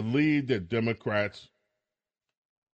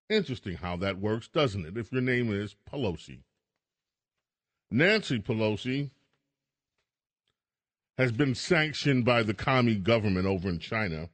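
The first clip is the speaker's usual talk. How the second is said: normal talking voice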